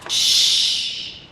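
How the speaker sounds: long unvoiced shush; hushing. Grover hushes the reader before sharing an idea, shushing. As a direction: hush them with a drawn-out shh.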